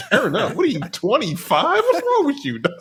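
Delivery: mock incredulous. Retelling his own reaction.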